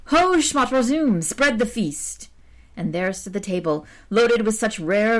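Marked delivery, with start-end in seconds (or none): 0.1-2.3 slow, high call to an unseen servant; stately summons to the feast; calling out commandingly. Acted. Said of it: "the archer cried".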